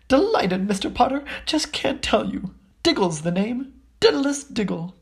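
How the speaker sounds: high breathless delight; squeaky delighted little man; excited high voice. Acted. Diggle's excited greeting.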